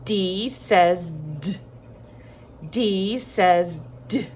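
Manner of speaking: "dee says duh" for D says D; name D, then a short plosive D, evenly spaced; letter then sound. Shown as a demonstration. Teacher leading children to repeat, D says D as "dee says duh". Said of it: "Say it with me".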